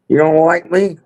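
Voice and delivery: high, mocking whine of a question; mocking impression. Doing an impression that Billy does better, mocking. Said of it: "Billy does it better".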